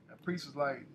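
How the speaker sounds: usual speaking voice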